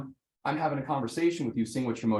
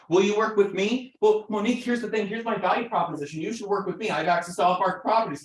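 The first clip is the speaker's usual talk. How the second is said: higher, louder pushy agent pitch; a salesman pressing Monique to sign, higher and louder; pushy value pitch; 9 semitones higher, 4 dB louder than usual. Pretend. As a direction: sell yourself too hard. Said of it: "their perspective is".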